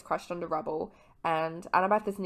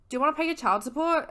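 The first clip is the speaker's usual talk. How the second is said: higher, fast, snarky; sassy jab; 9 semitones higher, 4 dB louder, faster than usual. Retold or imitated. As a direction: fire it off as a jab. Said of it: "he's like".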